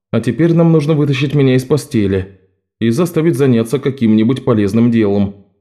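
ordinary delivery, nothing marked

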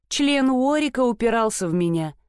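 ordinary delivery

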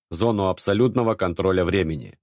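ordinary delivery

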